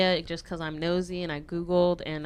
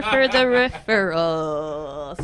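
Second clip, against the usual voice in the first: mock thanks; 5 semitones higher, 9 dB louder, much slower than usual.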